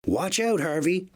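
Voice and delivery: Henry warns Harvey, calling out; warning call. A narrator's reading. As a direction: cry out to stop a crash. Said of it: "tooted Henry".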